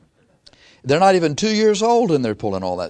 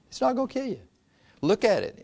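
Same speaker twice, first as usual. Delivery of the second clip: higher, softer sly tempter voice; tempting devil; 11 semitones higher, 6 dB quieter, faster than usual. Acted. Devil urging Eve to look at the tree, higher sly voice. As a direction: lure her in, much higher and softer.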